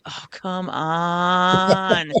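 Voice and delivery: very slow, dragged-out complaint; exasperated groan. Retold or imitated. Fan fed up with a dive, drawn-out groan. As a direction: groan it out long. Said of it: "then you're like".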